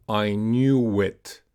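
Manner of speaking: slow "I knew it" joined by a w; "knew it" run together in a slow "I knew it"; linked w glide. Shown as a demonstration. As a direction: stretch "I knew it" as I knew-wit. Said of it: "connected with the off glide U".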